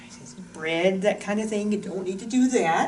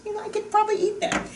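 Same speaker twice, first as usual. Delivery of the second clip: pleasantly surprised taster; 13 semitones higher, faster than usual. Retold or imitated. Voicing a viewer trying olives, high surprised taste. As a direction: play a taster warming up to it. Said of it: "You say".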